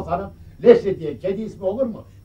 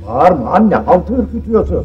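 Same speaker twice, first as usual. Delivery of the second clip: loud; 10 dB louder than usual. Acted. Chasing the grandmother.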